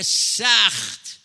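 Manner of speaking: سخت hammered slowly for emphasis; drawn-out stress on سخت. Real, not acted. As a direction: lean hard into سخت, drag it out. Preacher stressing how severe.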